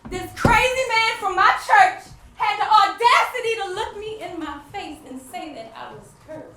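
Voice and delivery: bursting out loud and high, then settling; loud indignant outburst fading to plain; outraged. Real, not acted. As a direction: hurl the outrage at him, then let it drop. Outraged at the churchman's words.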